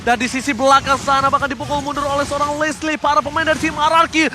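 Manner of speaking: screaming play-by-play; esports caster. Real, not acted. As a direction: shout the team fight at full hype. Team fight hype call.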